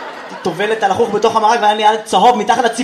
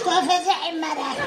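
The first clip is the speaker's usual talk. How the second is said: put-on higher, softer old grandma; lightly voiced Yemenite grandmother; 7 semitones higher, 8 dB quieter than usual. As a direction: answer like a curt grandma. Grandmother voice again.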